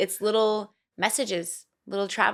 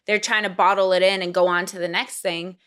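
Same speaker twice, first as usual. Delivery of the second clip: ordinary delivery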